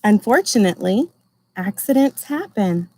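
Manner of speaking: gentle storybook reading voice; reading to children. Acted. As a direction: read it like a picture book for a class. Teacher reads picture book aloud.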